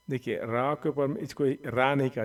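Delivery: ordinary talk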